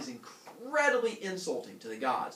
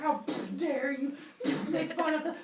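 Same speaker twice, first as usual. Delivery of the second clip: mock furious yell; 13 semitones higher, 6 dB louder than usual. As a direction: berate her while beating her. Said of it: "while yelling at her".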